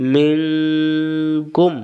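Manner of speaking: মিং held long and nasal before কুম; hidden nun. Shown as a demonstration. Teacher reviewing the example, long nasal মিং then কুম. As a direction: hum through মিং, then close on কুম. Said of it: "নুন সাকিন গোপন".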